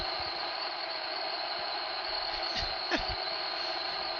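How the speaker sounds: quiet breathy laugh starting low; soft low laugh. Acted. Pinkie, fear lifting, a soft low laugh. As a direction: let the laugh creep out softly. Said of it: "She was laughing".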